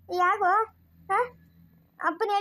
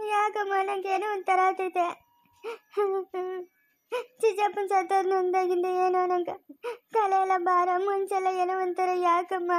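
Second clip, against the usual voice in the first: weeping. Acted. Grieving child telling mother of feeling strange, weeping. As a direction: sob between the phrases, bewildered by grief. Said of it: "ಅಳ್ಬೇಡ ಸುಮ್ನಿರಪ್ಪ".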